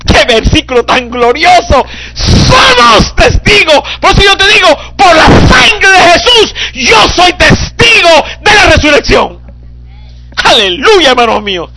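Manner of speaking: ecstatic preaching. Acted. Preacher declaring himself witness of resurrection, crying shout. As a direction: testify with everything you have.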